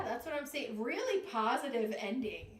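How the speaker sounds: ordinary talk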